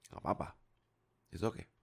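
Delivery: usual speaking voice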